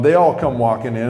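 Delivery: usual speaking voice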